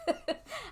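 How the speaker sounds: 2 high breathy giggle at herself; 3 giggle at fumbling words; 4 embarrassed giggle mid-sentence; 1 real laugh. Real, not acted.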